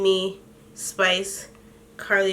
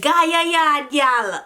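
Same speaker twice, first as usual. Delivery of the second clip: higher put-on Patois call, laughing; mock Patois yell; 5 semitones higher, much slower than usual. Imitating [name] yelling after [name] in Jamaican Patois. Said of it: "Spice yells out".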